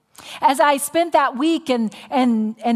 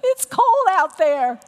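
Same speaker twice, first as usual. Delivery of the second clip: reluctant protest; 12 semitones higher, 5 dB louder, slower than usual. Retold or imitated. I'm like.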